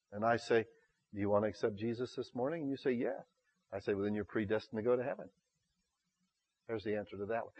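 very soft, low preaching voice; hushed altar appeal. Real, not acted. Preacher asking listeners to accept Jesus this morning, hushed. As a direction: put the question and answer gently, almost under the breath.

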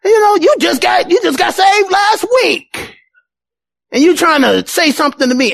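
mock indignant shout. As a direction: snap back at a new convert. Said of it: "we're like".

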